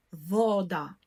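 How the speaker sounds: вода stressed on the first syllable, ВО; наголос на передостанній склад. Shown as a demonstration. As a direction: lean on во, let да fall. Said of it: "Наголос падає на передостанній склад". (вода з наголосом на во)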